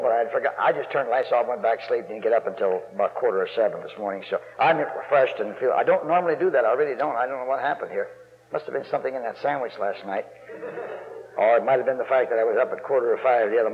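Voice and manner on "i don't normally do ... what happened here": ordinary delivery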